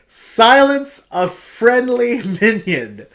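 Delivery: mock disbelief, laughing through the word minion; incredulous laughing re-read. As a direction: drag the words out as if the card is a joke. Incredulous re-read of card text.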